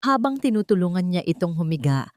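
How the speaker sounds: ordinary talk, nothing marked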